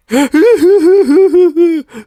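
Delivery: loud, high, over-the-top mock gasp; a dramatic fake gasp of horror. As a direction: ham up a shocked gasp. Mock reaction to Pork Chop's death.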